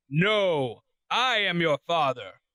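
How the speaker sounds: low, slow, portentous line reading; movie villain quote. Acted. Host reads trivia option B, movie villain quote.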